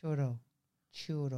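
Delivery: put-on half-hearted Spanish accent, low and falling; mock Spanish accent. Copying her co-host's Spanish accent on churro.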